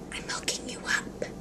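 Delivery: whispered aside, all breath and no voice; conspiratorial whisper. Retold or imitated. Shop guy tells customer of the hookup, whispering. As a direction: let them in on a secret. Retold confidential tip about discount.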